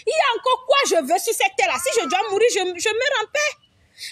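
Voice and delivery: sudden high cry to God, then slowing down; relieved cry. Retold or imitated. Recalling being comforted by friends, a high cry of relief. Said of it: "Je me suis dit".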